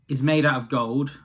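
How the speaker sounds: ordinary delivery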